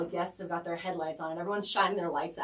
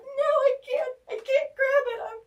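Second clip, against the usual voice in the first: very high, loud wailing; hammed-up helpless squeal, near crying; reenacted panic; 10 dB louder than usual. Reenacts her panic at the crash.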